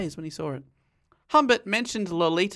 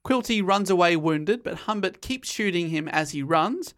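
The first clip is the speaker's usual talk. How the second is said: ordinary talk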